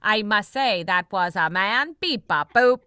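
C-3PO robot bit with beeps. Acted. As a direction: play it like a fussy droid. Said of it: "his C three P O imitation".

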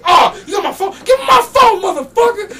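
high, furious mock shout; so mad. Rapper demanding his phone from the roadie, mad imitated shout. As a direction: demand it loudly, imitating an enraged man. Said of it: "he's like so mad".

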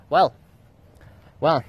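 usual speaking voice

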